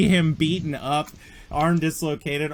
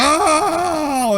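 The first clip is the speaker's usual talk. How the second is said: high, loud howl of pain; acted pain cry; 6 dB louder than usual. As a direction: cry out as if your arm just snapped. Victim's arm is snapped.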